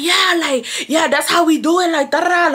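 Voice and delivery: high, swaggering mock gang-girl voice; tough gang girl. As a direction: show off like a girl flashing gang signs. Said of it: "Amanda was like".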